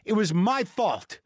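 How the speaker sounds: high, worked-up admission; exasperated self-blame. Retold or imitated. Man admitting his bag got pulled through his fault, exasperated self-blame. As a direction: own the mistake in exasperation, slowing on the repeat.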